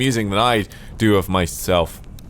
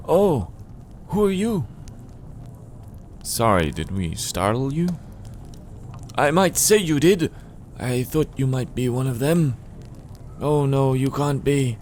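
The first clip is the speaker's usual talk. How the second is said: put-on old eccentric, pitched up in surprise; lightly voiced suspicious old man; startled old man; 7 semitones higher than usual. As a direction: play a jumpy old hermit caught singing. Reader plays Donald, startled old man.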